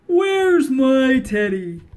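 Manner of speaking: slow, loud, whiny bear voice; a huge bear whining for his toy; whining big bear. Acted. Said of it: "A great big voice whined".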